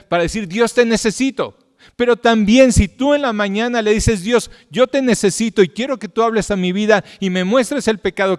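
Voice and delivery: high, loud fervent preaching. Acted. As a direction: preach it out to the whole church with full force. Preaching at full force.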